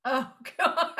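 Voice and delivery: real laugh at a groaner. Real, not acted. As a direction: laugh it out, amused. Reacting to the joke.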